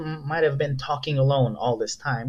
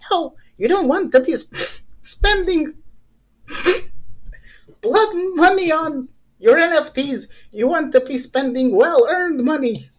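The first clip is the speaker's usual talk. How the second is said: much higher, earnest angel on the shoulder; 12 semitones higher than usual. Pretend. The good conscience warns him not to post, in a high saintly voice. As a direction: preach honesty in a high, saintly voice. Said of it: "doing the voice like this".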